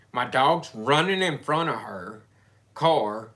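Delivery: ordinary talk, nothing marked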